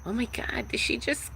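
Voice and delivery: high, hushed, gasping mock outrage; high hushed mock gasp of people judging her; mock scandalized onlooker. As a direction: play the shocked gossip. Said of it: "They be hella uncomfortable".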